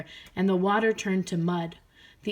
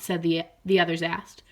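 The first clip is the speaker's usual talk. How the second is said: usual speaking voice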